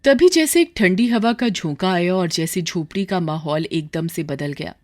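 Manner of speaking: ordinary delivery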